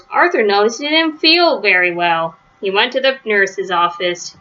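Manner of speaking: usual speaking voice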